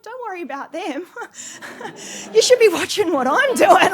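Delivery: high and very loud, bursting into laughter; cheeky laughing retort. Retold or imitated. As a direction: toss it off cockily while cracking up. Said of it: "And I'm like".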